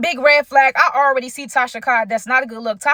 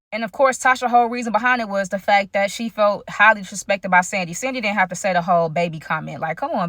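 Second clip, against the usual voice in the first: usual speaking voice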